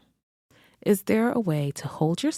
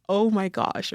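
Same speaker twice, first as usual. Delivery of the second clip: moved; 4 semitones higher than usual. Retold or imitated. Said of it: "I teared up".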